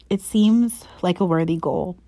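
ordinary delivery

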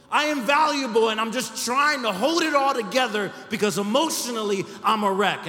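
loud, high, pleading outcry; desperate plea. Retold or imitated. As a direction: cry out that you are worth something. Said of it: "This is what she was saying".

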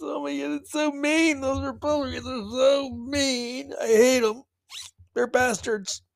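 high, whiny mock outrage; mocking whiny liberal. Mocking the left's whining tangents, high whiny mock outrage. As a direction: whine it like a hurt liberal. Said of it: "go on their little tangents".

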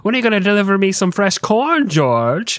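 high-pitched demanding mom voice; mock nagging mother; George Washington's mother. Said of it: "who was basically like".

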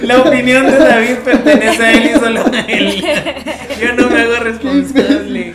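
slow, loud, mock-official disclaimer voice. Distancing herself from David's Haiti answer, mock-official announcement. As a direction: deliver it like a solemn legal notice, hamming it up.

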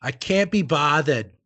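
put-on slow Boston accent with dropped R's. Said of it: "something to do with".

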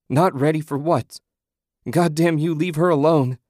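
loud, high, anguished shouting; husband cursing the spirits taking his wife; furious yell. A narrator's reading. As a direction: rage at them to let her go. Husband yelling at figures taking wife.

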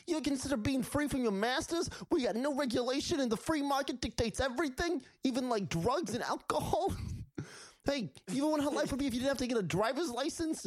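very high squeaky imp preaching; pushy little imp selling freedom; libertarian Impmon. Retold or imitated. Co-host voicing Impmon as a libertarian, high squeaky. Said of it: "He's like".

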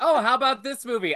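loud, high, laughing mock enthusiasm; mocking eager fan. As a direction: ham up the gushing young fan. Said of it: "you guys will be like".

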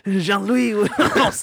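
high, loud hammed-up French accent. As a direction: cry out as a panicking cartoon Frenchman. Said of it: "You got a good French accent".

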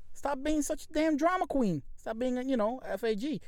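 high-pitched retold outburst; pissed off, retold. Nephew retelling his uncle snapping in a store, mock pissed-off. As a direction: raise the pitch, not the volume, for a mock fed-up uncle. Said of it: "My uncle got pissed off".

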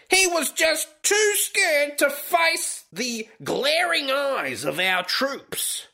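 high, slow, hammed-up propaganda taunt; mock North Korean newspaper. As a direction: draw it out high like a pompous decree. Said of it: "the newspaper, it read".

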